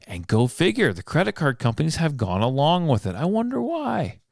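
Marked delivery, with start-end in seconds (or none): 3.2-4.2 high mocking sarcastic question. Real, not acted. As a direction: sneer it, sky-high and knowing. Sarcastic jab at companies.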